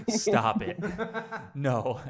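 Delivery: high, loud mock disbelief breaking into laughter; laughing, high-pitched retold protest. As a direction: protest loudly while cracking up. Retold disbelief at his mistake.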